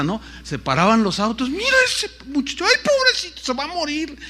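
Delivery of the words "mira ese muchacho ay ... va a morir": much higher, mock old-lady pity voice; pitying onlooker. Passing drivers pitying the bloodied young man, high mock onlooker voice. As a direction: play a fussing bystander clucking over him.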